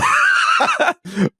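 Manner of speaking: real laugh that breaks into a shout. Real, not acted. Laughing about the late showing.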